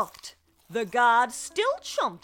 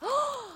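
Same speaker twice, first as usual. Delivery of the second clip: sharp gasp. A narrator's reading. Jacob's sudden idea.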